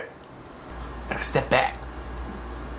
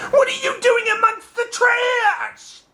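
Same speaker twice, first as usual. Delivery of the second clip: high, slow, loud incredulous mother; high drawn-out demand; shocked mom; 3 dB louder, slower than usual. Retold or imitated. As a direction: scold like a stunned mom. Mom confronting.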